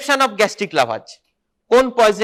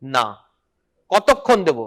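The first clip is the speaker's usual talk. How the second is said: ordinary delivery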